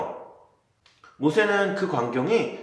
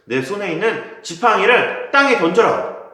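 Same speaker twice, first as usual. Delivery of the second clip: firm command; 7 semitones higher, 7 dB louder than usual. Acted. God commanding Moses.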